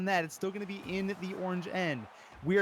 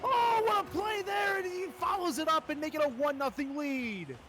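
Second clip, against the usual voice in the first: excited goal call; 11 semitones higher than usual. Real, not acted. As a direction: scream the goal for the crowd. Caster calling the winning goal.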